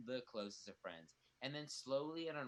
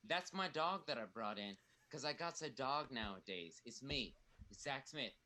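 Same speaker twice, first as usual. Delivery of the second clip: usual speaking voice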